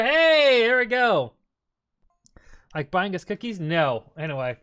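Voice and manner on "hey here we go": excited. Real, not acted. Streamer reacts to spin.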